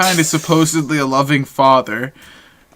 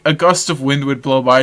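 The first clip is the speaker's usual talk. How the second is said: ordinary talk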